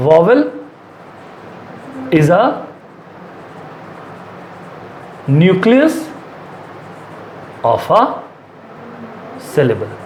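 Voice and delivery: broken into chunks with long gaps; dictating while writing. Real, not acted. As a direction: speak word by word, pausing to write each. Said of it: "मैं लिख देता हूँ". (लिखते समय एक-एक शब्द रुक कर बोलना)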